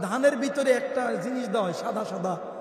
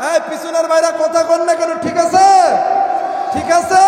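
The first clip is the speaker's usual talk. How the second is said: loud, raised sermon harangue; fiery preaching; 5 semitones higher, 11 dB louder than usual. Real, not acted. Preacher scolds sons who obey their wives, fiery loud preaching. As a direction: berate them at full force from the pulpit. Rouses the audience.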